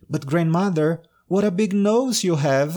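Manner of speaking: put-on high, wide-eyed little girl; amazed young girl. Girl marvelling at the wolf.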